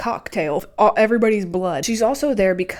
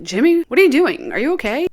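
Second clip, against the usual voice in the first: higher, louder, startled calling out; alarmed call; 5 semitones higher, 5 dB louder than usual. Retold or imitated. Principal calls out to the fallen boy, alarmed and louder.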